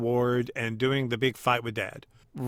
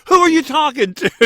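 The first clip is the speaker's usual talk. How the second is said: imitating him, higher and louder: what is that?; mock bewildered shout, much higher; incredulous high outburst; 13 semitones higher, 7 dB louder than usual. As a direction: play a startled man demanding answers. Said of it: "Steve goes".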